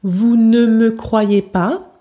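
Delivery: "Vous ne me croyez pas" slowly with a full "ne". Shown as a demonstration. Teacher giving the sentence to turn informal, slowly. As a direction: read it like a written sentence, slowly. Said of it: "prononce de manière informelle".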